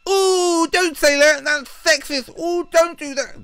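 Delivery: very high, whiny falsetto; squeaky mock outrage; mocking little-boy voice. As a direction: mimic a prissy, scolding little boy. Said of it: "little boys will be playing their little games and going".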